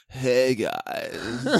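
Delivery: lightly put-on, drawn-out greeting; Brian impression. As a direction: stretch the greeting like the absent friend. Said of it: "do your best Brian impression".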